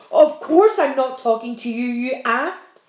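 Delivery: loud, impatient centipede voice; gruff centipede. A narrator's reading. Centipede brushing off James, annoyed. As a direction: snap back, irritated and loud. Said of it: "the centipede answered".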